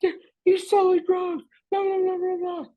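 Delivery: very high, loud tattle; little brother tells mom, very high and loud; tattling kid. Retold or imitated. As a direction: squeal on him like a little kid. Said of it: "he's like".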